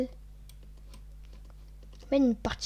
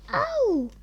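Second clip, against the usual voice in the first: very high sharp ow yelp; 13 semitones higher, 3 dB louder than usual. Pretend. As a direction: squeal a pop-star ow.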